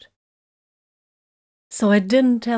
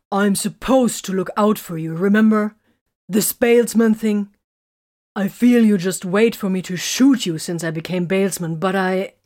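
loud, raised, indignant outburst; heated; 2 semitones higher, 6 dB louder than usual. A narrator's reading. Man protesting he should look out for her, heated. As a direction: argue hotly, pushing each point. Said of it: "he asked hotly".

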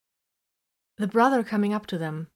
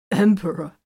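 laughing; 7 dB louder than usual. Acted. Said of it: "he said, laughing".

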